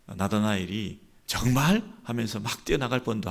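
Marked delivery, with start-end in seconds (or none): 1.3-1.8 high, loud, rising exclamation; eager surprise. Retold or imitated. Nathanael hearing his friend's news, eagerly surprised. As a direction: react with excited disbelief.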